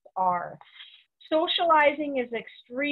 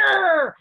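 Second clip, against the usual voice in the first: a short, loud snarling growl like a mother dog; mock mother dog growl; 6 semitones higher, 8 dB louder than usual. Mother dog snapping at a pestering pup, a loud mock growl. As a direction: bark out a quick growl, louder and higher. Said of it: "all of a sudden she turns around and she's like".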